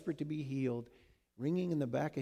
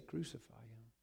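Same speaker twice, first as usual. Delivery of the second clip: much softer, a frightened half-whisper; afraid, under the breath; 2 semitones lower, 11 dB quieter than usual. Retold or imitated. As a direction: say it as if ashamed to.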